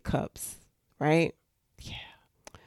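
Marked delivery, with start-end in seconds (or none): 1.8-2.6 barely audible whisper; hushed little aside. Real, not acted. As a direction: drop to a tiny voiceless murmur. Whispered aside after describing cups.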